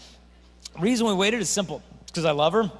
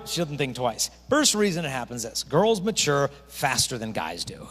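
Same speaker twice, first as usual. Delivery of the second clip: ordinary delivery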